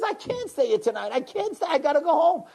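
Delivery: high-pitched, insistent excuse; protesting father. Retold or imitated. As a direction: act out a harried dad pleading to go. Father acted, protesting to pleading child.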